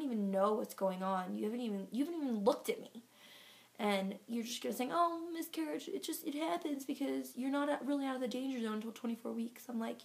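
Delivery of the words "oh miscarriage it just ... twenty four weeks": high, singsong mock of a glib doctor; sarcastic imitation, starting high and easing down. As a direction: parrot it back in a higher, scornful voice. Mocks doctor's glib miscarriage remark.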